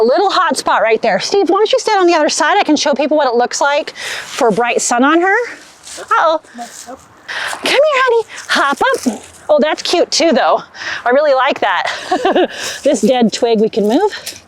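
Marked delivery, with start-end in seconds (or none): 6.0-9.2 high, sweet coaxing call; talking to a dog. Real, not acted.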